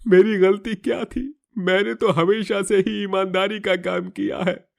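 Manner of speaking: defeated and broken; despairing. Acted. Jailed man in despair.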